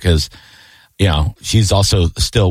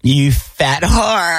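high, slow drawn-out mock insult; mock catty girlfriend; 6 semitones higher, much slower than usual. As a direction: play a catty friend dragging out the insult. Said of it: "chicks talk to each other".

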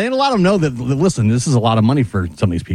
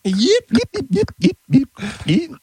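alien voice; 8 semitones higher than usual. Pretend. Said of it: "that's how they talk".

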